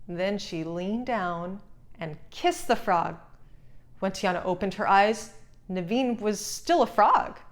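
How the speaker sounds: ordinary delivery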